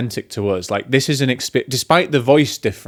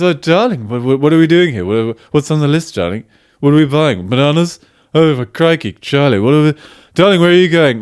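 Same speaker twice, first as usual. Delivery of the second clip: flustered, overstimulated shopper in a put-on accent; Darling character; 3 semitones higher, 4 dB louder than usual. Shown as a demonstration. Demonstrating his sketch character on a shopping trip, hammed-up accented fluster. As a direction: play a panicky husband fussing round the shops. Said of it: "he talks like this".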